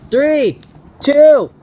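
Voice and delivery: loud, high countdown shout; calling countdown. Real, not acted. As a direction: call out the start. Countdown to start the match.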